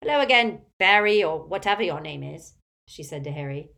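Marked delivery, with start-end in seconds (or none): 0.0-2.5 loud, domineering Auntie Muriel character voice; imperious tipsy old aunt. A narrator's reading. Aunt greeting Harry at the table, imperious and loud. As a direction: project like a tactless old relative.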